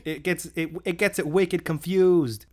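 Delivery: high, slow Bostonian "wicked confused"; mock Boston accent. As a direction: play along with a hammed-up Boston accent. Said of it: "It's wicked".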